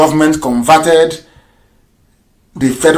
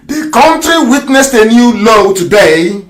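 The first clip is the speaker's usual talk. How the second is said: reading very slowly; 4 semitones higher, slower than usual. Shown as a demonstration. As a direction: read each word slowly so a dull listener follows. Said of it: "I'll read very slowly so you understand it".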